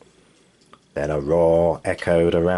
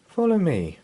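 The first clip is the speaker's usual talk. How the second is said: put-on child voice; 10 semitones higher than usual. Son's voice.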